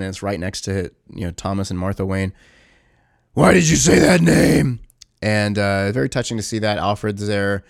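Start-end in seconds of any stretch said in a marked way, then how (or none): 3.3-4.8 slow, loud, higher hammed-up cry; a loud, drawn-out parody of a tortured hero; mock anguished yell. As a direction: ham up the desperate movie outburst. Quoting a famous movie outburst.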